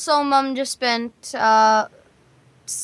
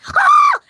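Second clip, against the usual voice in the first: sudden high shriek, much louder; voiceover spaz shriek; 7 dB louder than usual. Retold or imitated. Voice actor showing a studio spaz, high loud shriek. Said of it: "they always do this".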